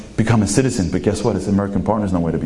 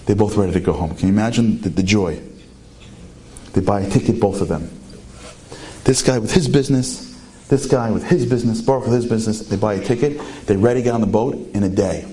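ordinary delivery

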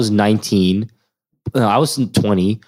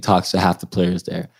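normal talking voice

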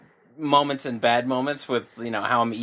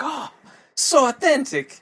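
Italian grandma voice; 12 semitones higher, slower than usual. Retold or imitated. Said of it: "She's like".

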